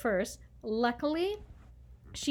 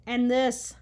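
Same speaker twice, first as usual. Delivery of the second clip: usual speaking voice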